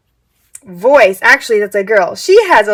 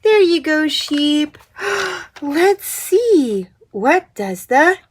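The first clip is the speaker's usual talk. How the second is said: put-on soft, slow toddler talk; reading to a toddler; 5 dB quieter, slower than usual. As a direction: coo it gently to a little one. Talking to toddler about puzzle.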